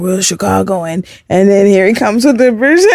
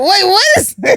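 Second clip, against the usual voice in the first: high, laughing incredulity; amused disbelief; 11 semitones higher than usual. Retold or imitated. Listener reacting to actor naming an LA accent, incredulous. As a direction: repeat it as if baffled, breaking into laughter. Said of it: "I was like".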